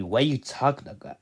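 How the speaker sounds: normal talking voice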